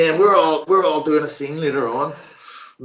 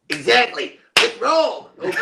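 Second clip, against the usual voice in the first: loud, punchy go-ahead, jumping higher; imitated director; 11 semitones higher, 7 dB louder than usual. Director starting the take, loud and excited. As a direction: call the take with gusto. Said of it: "And he goes".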